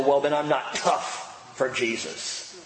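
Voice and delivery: ordinary talk, nothing marked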